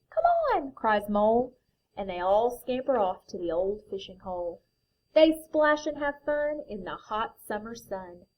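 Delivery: lively picture book reading; reading to children. A narrator's reading. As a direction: read it like a bedtime story.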